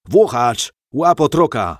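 urgent roared command. A narrator's reading. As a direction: bellow the order to your henchman. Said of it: "ryknął ktoś".

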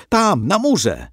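high, urgent pointing-out cry; excited boy's yell. A narrator's reading. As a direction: call out eagerly so your mother looks. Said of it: "wrzasnął Johann".

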